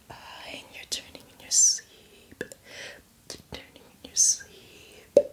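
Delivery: slow, drawn-out whisper fading away; hypnotic lullaby whisper. Acted. Zombie guide putting a newcomer to sleep, slow hypnotic whisper. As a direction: lull them under, stretching each word.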